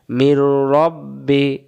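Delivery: slow মির রব্বি with doubled র; slow recitation. Shown as a demonstration. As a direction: recite it drawn out. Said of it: "তাসদিদ হয়ে গেল মির".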